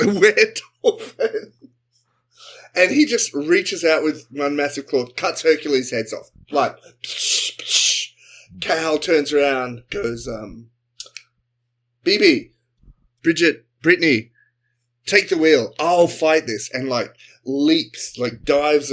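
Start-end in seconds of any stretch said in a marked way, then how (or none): 0.1-1.5 laughing creature name. Acted. Riffing on partner's suggestion, laughing. 12.0-16.7 heroic command. Acted. Captain ordering crew mid-fight.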